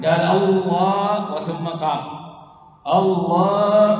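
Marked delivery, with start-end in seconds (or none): 0.2-1.3 slow, raised "الله" with a long held vowel; takbir begun seated. Shown as a demonstration. Teacher acting someone starting the takbir seated, long chanted "الله". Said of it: "ابتدأها بغير وهو قاعد". 2.9-4.0 loud, slow "الله", held on a higher note; takbir begun seated. Shown as a demonstration. Teacher acting someone rising mid-takbir, long chanted "الله".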